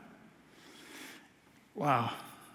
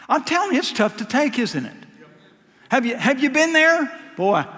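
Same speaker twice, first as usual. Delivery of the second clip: normal talking voice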